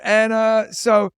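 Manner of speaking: ordinary talk, nothing marked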